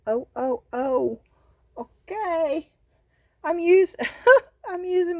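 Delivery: high, loud thrilled oh-oh-ohs breaking into a laugh; giddy, excited laughing; excited squeals. Real, not acted. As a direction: squeal with delight at the result. Thrilled at resin result.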